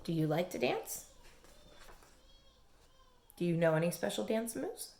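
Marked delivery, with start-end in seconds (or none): none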